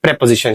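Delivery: प्रीपोजिशन opening with short "prep"; corrected pronunciation. Shown as a demonstration. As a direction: model प्रीपोजिशन as "prep-uh-zishun", falling at the end. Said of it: "ये प्रीपोजिशंस होते हैं". (प्रीपोजिशन को "प्रेपोजिशन" जैसा बोलें)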